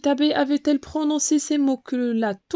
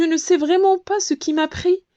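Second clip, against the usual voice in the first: mock sorry; 8 semitones higher, 3 dB louder than usual. A narrator's reading. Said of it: "faisant soudain la désolée".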